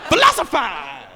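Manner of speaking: loud laughing cry; hammed-up triumphant shout; triumphant yell. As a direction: crow it like a victory.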